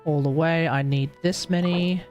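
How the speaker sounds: normal talking voice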